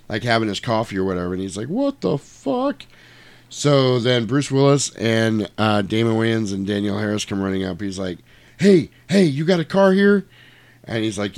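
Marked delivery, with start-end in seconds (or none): 1.7-2.8 high, slow, drawn-out mock astonishment; stunned disbelief. Poolside man seeing the crash, mock stunned disbelief. 8.6-10.3 loud, high acted hail; urgent calling out. Running up to a stranger poolside, urgent mock hail.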